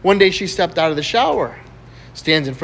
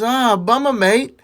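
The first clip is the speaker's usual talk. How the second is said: put-on Australian accent; 9 semitones higher, 5 dB louder, slower than usual. Australian coast guard on phone.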